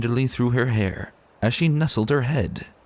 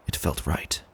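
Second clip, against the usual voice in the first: hushed resolve; 6 semitones lower, 6 dB quieter than usual. A narrator's reading. Quiet inner resolve.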